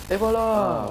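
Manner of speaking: high, loud flourish of success; triumphant cry. Real, not acted. Player celebrates solving the puzzle, triumphant cry. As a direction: announce it with a proud burst.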